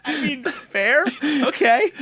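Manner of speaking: talking through laughter; giggling while answering; laughing. Real, not acted. Laughing at friend's remark.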